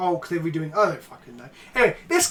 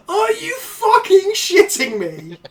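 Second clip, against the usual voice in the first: loud, high, slow laughing disbelief; laughing outraged shout; 11 semitones higher, 6 dB louder, slower than usual. Real, not acted. Shocked realisation of pun.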